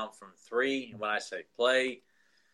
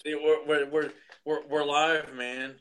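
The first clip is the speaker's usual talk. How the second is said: wrestling promo; 4 semitones higher, slower than usual. Retold or imitated. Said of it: "like Sid Vicious cutting a promo".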